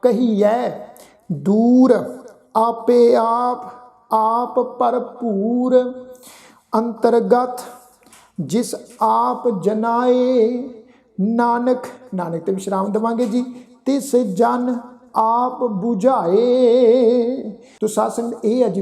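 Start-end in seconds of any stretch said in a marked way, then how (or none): 1.3-11.9 slow, drawn-out sacred recitation; measured Gurbani reading, sustained line endings; scripture recitation. Shown as a demonstration. 13.9-17.5 slow, drawn-out sacred recitation; measured Gurbani reading, sustained line endings; scripture recitation. Shown as a demonstration.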